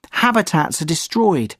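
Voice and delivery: ordinary delivery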